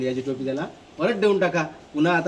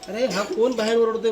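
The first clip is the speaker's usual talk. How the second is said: higher, sharp annoyed call; a king irritated by noise outside; 6 semitones higher, 4 dB louder than usual. Acted. Angry king hears shouting. (चिडलेला राजा, वरच्या सुरात)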